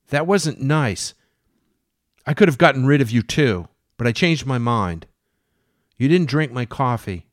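a mad woman serving the captain breakfast; Jane. Acted. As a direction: play her as doting and unhinged. Jane voiced as a character.

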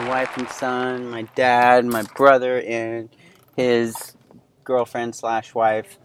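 normal talking voice

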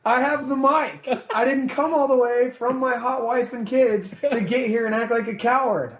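daring the crowd to come at him; mock tough challenge. Retold onstage challenge to heckler.

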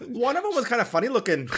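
put-on high, quick Fargo accent; imitated Fargo accent. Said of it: "and they're like".

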